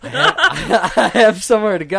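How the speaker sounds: high, loud and quick, half laughing; hammed-up hurry to leave; mock hurried excuse. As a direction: pretend to rush off, laughing. Joking he must rush off mid-game.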